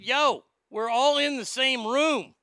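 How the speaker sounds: mock holler at the loud guy; loud call-out. Brother telling the loud guy off across the room, loud slow call.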